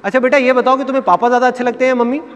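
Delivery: imitated relative in a high, coaxing voice; high-pitched mock adult-to-child talk; calling children over. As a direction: summon a little child to perform for guests. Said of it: "बच्चों को बुलाया जाता है".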